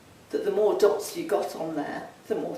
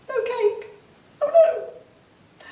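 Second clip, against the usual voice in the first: mock despair; 6 dB louder, much slower than usual.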